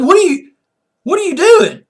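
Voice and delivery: high, loud disbelief; incredulous. Retold or imitated. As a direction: question it in exasperated disbelief. Incredulous reaction to referee calling police.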